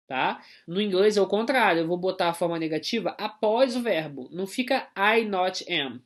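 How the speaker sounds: ordinary talk, nothing marked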